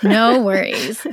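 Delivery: said through a laugh. Real, not acted. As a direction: laugh it off gently. Laughing off a memory slip.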